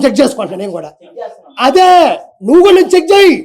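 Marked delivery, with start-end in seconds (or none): 1.7-3.3 high, loud snap back; loud indignant comeback; heated retort. Real, not acted. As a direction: fire it right back at him.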